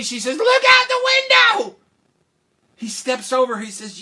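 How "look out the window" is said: very high, loud yell; hilarious shriek. Acted. Princess telling the king what's funny, high shriek. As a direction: shriek it as if helpless with mirth.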